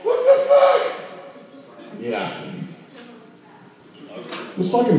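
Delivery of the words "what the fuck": put-on kid's panic. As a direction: shriek like a scared little kid at the swarm. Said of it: "I went".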